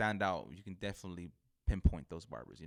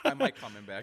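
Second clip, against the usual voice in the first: laughing; 3 semitones higher, 8 dB louder than usual. Real, not acted. Joking while laughing.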